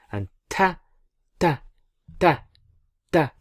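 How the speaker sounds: "ta" said light and thin, like an English T; ta, ta, ta with a light T, no heaviness. Shown as a demonstration. Teacher contrasting the heavy Da with a light "ta". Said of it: "Bit like a T".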